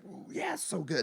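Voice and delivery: a smoker savoring his last cigarette, whispered and soft. Retold or imitated. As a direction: whisper it like the best smoke ever. Retold savoring last cigarette drags.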